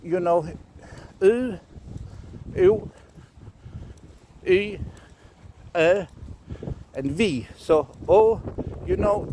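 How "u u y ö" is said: U, U, Y, Ö as isolated vowels, pitched up; rune vowel sounds. Shown as a demonstration. Teacher repeating the U rune's sounds, isolated vowels. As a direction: say U, U, Y, Ö with long gaps, higher. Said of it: "so the sounds are".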